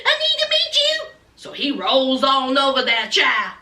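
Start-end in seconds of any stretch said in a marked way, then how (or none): 0.0-1.1 high mock demanding voice; high put-on voice, pushy; imitating Destiny. Retelling how Destiny called Sean, high mock voice. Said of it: "She says".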